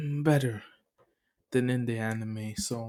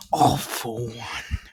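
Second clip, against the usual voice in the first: mock dramatic villain call; 4 dB louder, much slower than usual. Villain's name dramatically announced.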